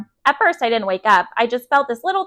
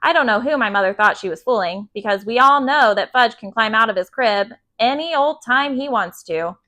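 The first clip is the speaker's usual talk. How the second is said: ordinary delivery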